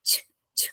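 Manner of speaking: short crisp ch alone; ch sounded by itself; isolated ch sound. Shown as a demonstration. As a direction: demonstrate the British ch of Tuesday. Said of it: "this is the sound".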